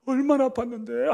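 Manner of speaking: put-on high, loud whining child to mother; whiny child. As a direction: whine for mommy's sympathy. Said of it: "엄마 품에 안겨서 응석을 부려요". (엄마에게 응석 부리는 아이처럼 칭얼대며)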